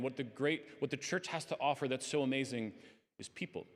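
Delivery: ordinary talk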